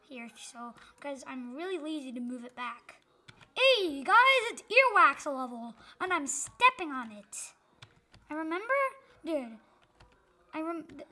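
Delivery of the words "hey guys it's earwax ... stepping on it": YouTuber intro. Pretend.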